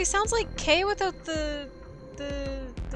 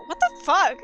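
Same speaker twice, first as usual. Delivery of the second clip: loud, higher swearing in disbelief; stunned outburst; 4 semitones higher, 6 dB louder than usual. Real, not acted. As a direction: blurt it out, floored by the news. Still stunned by casting news.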